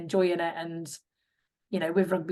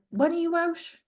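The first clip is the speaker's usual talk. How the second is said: much higher, eager coaxing question; teasing coach; 10 semitones higher than usual. Retold or imitated. Welsh coach pestering her at games, high teasing mock question. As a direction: nudge her like a keen coach.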